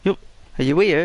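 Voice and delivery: high calling out for his son; worried dad shouting up the stairs. Retold or imitated.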